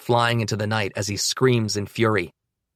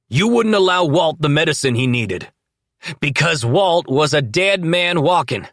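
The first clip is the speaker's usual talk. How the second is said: loud, firm, raised voice; loud but controlled; 4 semitones higher, 5 dB louder than usual. A narrator's reading. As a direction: stay calm but project. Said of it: "remain calm while still speaking loudly".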